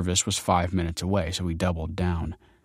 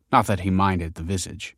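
ordinary delivery

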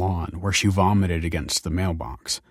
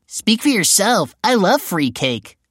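put-on teen boy voice; 13 semitones higher, 6 dB louder, slower than usual. Teen son voiced in story.

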